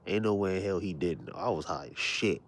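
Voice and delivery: ordinary talk, nothing marked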